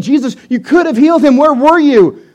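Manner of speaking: loud, high, upset accusation; frantic reproach. Acted. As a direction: plead and blame, frantically. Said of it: "she's frantic and she's just doesn't really understand".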